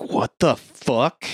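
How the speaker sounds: slow, drawn-out disbelief; mock bewildered question; retold bafflement. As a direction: stretch it out, imitating someone baffled. Retold baffled reaction to creepy stranger.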